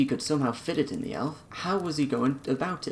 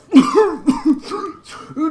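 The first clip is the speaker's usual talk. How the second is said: choking splutter; 13 dB louder than usual. Acted. Choking on tea.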